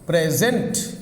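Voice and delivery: "present" with its second syllable lifted and stressed; stress on last syllable. Shown as a demonstration. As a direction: push the weight onto the end of "present". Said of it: "on last syllable".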